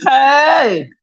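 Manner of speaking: loud high shout; calling out. Real, not acted. Vlogger calling out to someone nearby, loud shout. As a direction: call out sharply to get attention.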